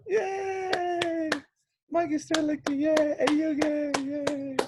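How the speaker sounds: tiny high-pitched cheering voice; pretend cheering fan. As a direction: squeal mock applause for yourself.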